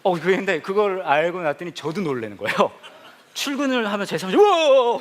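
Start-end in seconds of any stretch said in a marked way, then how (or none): 4.4-5.0 loud high yelp of fright; startled wow. Retold or imitated. Pastor startled by his own portrait, loud high wow. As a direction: jump at the sight. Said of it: "막".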